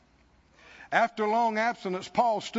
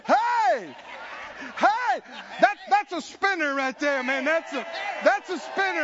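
high, loud whooping with laughter; joyful shouting; 6 semitones higher, 3 dB louder than usual. Acted. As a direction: celebrate surviving the storm. Rescued believer celebrating.

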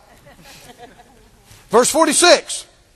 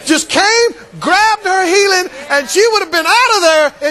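preaching shout; 7 semitones higher, 3 dB louder than usual. Acted.

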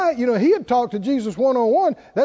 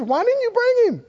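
indignant Pharisee; 12 semitones higher than usual. Retold or imitated. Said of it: "and they said".

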